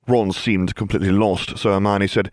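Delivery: usual speaking voice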